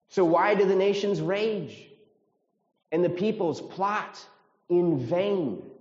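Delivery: high, loud incredulous question; incredulous ridicule. Acted. Preacher voicing the psalm's question to the congregation, incredulous. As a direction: read it as astonished at something ridiculous. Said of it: "this is how it sounds".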